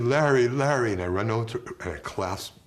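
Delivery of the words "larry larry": called out louder, pitch raised; a distraught father calling his name. Retold or imitated. Grieving father calling out to him.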